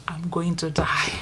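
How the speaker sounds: slow whispered dread. Retold or imitated. Child thinking she will die, whispered. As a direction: breathe it in terror. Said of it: "In my head".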